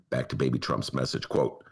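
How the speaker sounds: ordinary delivery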